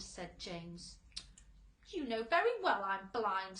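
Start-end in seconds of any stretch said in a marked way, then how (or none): 1.9-3.6 loud irritated retort; snapping. A narrator's reading. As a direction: bite back, offended. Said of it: "snapped the earthworm".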